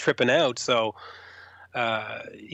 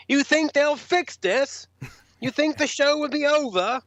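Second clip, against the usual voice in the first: imitating [name]; 12 semitones higher than usual.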